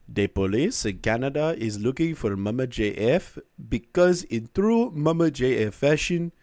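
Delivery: badly faked French Canadian accent, sliding into Jamaican; attempted posh French Canadian accent. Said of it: "a posh Canadian accent".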